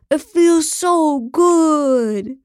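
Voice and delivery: high, very drawn-out cry of pleasure; faked ecstasy. A narrator's reading. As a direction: stretch every word in hammed-up bliss. Faking loud sex for cameras.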